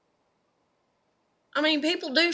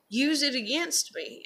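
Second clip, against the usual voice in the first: ordinary delivery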